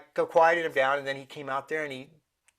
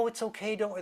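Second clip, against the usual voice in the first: higher-pitched, soothing comfort; gentle reassurance; 6 semitones higher, 4 dB quieter than usual. Acted. Comforting a child who knocked the birthday cake over, gentle reassurance. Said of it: "you don't scream and yell at them".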